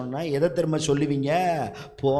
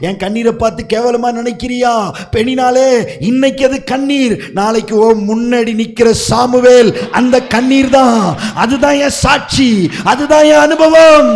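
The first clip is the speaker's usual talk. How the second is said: bold Hannah; 8 semitones higher, 14 dB louder than usual. Acted. Hannah answers Peninnah boldly.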